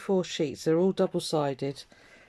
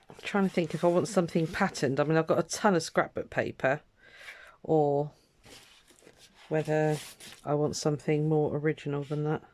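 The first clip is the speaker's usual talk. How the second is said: usual speaking voice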